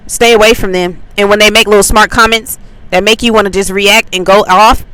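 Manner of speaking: usual speaking voice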